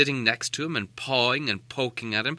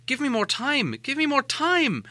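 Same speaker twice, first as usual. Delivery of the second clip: wanting to shout; 13 semitones higher than usual. Acted. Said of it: "He wanted to shout".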